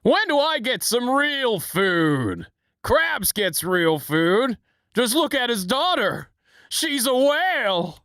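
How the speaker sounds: high, slow whiny little villain; Plankton impression. Plankton complaining about Krabs, high and slow. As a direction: whine like a tiny scheming pest. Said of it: "I can't do Plankton".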